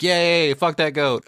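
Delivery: high, loud mock cheer; cheering crowd. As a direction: cheer it like a rowdy crowd at a festival. Villagers cheering at mock sacrifice.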